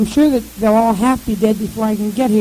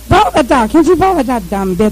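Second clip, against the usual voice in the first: ordinary delivery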